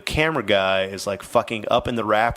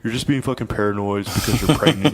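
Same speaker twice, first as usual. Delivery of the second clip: louder, higher mock-exasperated husband; dismissive husband; 4 semitones higher, 4 dB louder than usual. Estranged husband to pregnant wife, dismissive. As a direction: imitate a husband scoffing at his wife's fears.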